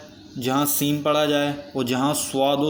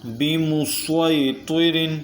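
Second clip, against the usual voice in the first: very slow, drawn-out tajweed chant; Quran recitation; 2 semitones higher, much slower than usual. Shown as a demonstration. Teacher recites the fourth word for students, slow melodic chant. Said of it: "چوتھا حرف ہے".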